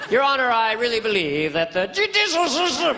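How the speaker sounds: high, slurred courtroom plea; hammed-up tipsy witness; mock drunk. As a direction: slur "judicial system" like a drunk. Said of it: "without sounding drunk".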